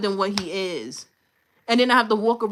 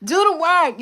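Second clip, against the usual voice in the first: higher, louder command; emphatic; 7 semitones higher, 6 dB louder than usual. Shown as a demonstration. Telling listeners to put in effort, loud and firm. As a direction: hit it hard like a coach. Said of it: "I don't know what to tell you".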